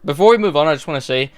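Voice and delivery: ordinary talk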